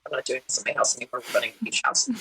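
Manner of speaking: a light hint of a firm grandmother, quick and soft; mock grandmother. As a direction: rattle it off like grandma, dropping to a whisper. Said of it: "she was like".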